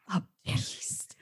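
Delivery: breathy mock ASMR whisper of a beast; whispered ASMR parody. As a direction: hush it like an ASMR dub. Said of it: "the A S M R whisper dub".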